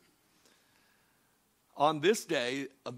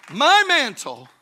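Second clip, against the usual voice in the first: preaching burst; 8 semitones higher, 13 dB louder, slower than usual. Acted.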